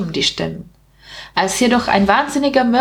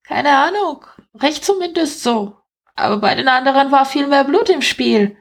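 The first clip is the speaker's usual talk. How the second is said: high-pitched little rat voice; chatty rat; 7 semitones higher than usual. Acted. Rat sizing up a sleeping man, high chatty voice. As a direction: play a small rat in a raised pitch.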